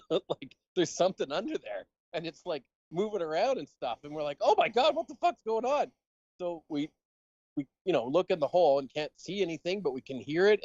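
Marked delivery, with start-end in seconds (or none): 4.4-5.9 high, startled yelp of alarm; shocked outcry. Retold or imitated. Camp counselor reliving finding the monster, high shocked yelp. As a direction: squeal it like you just saw something move in the dark.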